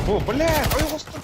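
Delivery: shocked exclamation. Real, not acted. Reacting to game moment.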